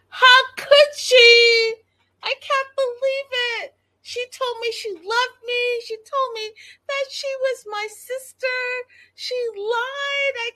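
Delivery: high, wailing mockery of a sobbing wife; imitating the betrayed wife in a shrill, high wail; mock crying wail. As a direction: whine it way up like a hammed-up meltdown. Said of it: "and she went off".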